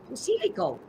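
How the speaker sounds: put-on Pacifico with an Italian accent. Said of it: "that's the Italian accent".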